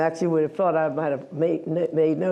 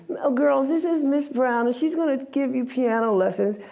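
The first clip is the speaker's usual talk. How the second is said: higher, sweetly bright hostess voice; imitating the program lady; 7 semitones higher than usual. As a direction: present the new teacher to the girls, pitched up. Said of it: "She's like".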